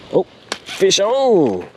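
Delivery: high excited call; excited shout. Real, not acted. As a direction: shout it as the fish bites. Fish hooked, calling out.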